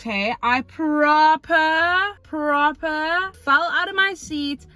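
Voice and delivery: milk it for dramatic effect; over-the-top excited. Retold or imitated. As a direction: stretch "proper fell out of my seat" high and slow. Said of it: "And I tell you what".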